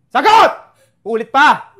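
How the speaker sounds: loud sudden shout; furious shouted demand; angry yell. Acted. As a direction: snap him into answering. Father demanding an answer.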